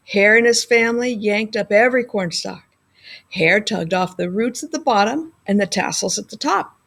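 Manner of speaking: usual speaking voice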